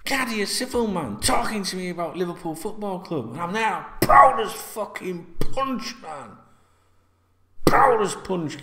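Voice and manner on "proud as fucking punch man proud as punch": proud. Real, not acted. Recalling pride at station.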